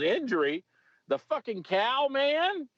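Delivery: high, slow stoner voice; slow, high, dopey drawl. Retold or imitated. Suspect to arresting officer, in a slow high stoner voice. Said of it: "He goes".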